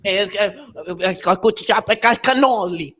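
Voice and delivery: mock Italian with a put-on stutter on ca- ca-; stuttering Italian impression. [name] revs up stuttering in fake Italian. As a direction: rev up and stumble into cannoli. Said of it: "a manufactured stutter".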